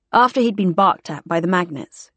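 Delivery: ordinary delivery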